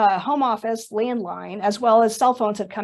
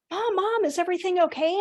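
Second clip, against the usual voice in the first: worried; 7 semitones higher, 3 dB quieter than usual. Retold or imitated. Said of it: "And I'm like".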